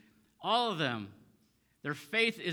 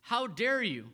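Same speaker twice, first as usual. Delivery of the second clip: indignant, higher-pitched rebuke; berating; 5 semitones higher, 4 dB louder, slower than usual. Retold or imitated. Pharisees berating the healed man in rebuttal, indignant. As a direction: scold him with slow, emphatic outrage. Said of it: "speak abusively towards him".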